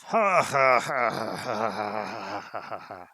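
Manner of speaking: big booming rolling laughter. Acted. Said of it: "a great rolling laugh".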